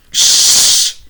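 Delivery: sharp whispered "Shh!"; commanding shush. Acted. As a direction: hush her firmly. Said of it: "commanded Mister Popper".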